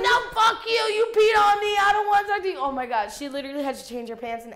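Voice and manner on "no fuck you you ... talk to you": angry yell. Retold or imitated. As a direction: scream at the dog to stay away. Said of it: "And she's like".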